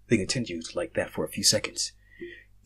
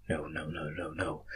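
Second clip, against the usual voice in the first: softly repeating no in disbelief; quiet panicked denial; 6 semitones lower, 12 dB quieter than usual. Acted. Narrator realizing he's in another world, quietly denying it. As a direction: murmur it, refusing to believe.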